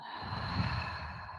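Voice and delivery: long breathy exhale out; slow deep breaths. Shown as a demonstration. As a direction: let a big breath go audibly. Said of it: "And exhale out".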